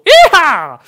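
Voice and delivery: high, loud cowboy whoop; cowboy yee-haw. Acted. American coach signing off his promise, loud yee-haw. Cri de cow-boy du personnage américain.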